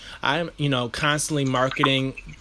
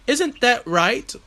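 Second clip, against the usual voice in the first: high, drawn-out mock British question; American playfully copying British speech; imitation British accent; 9 semitones higher, slower than usual. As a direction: put on a borrowed British accent. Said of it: "brought that accent to us".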